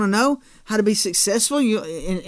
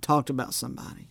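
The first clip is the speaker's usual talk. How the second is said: ordinary talk, nothing marked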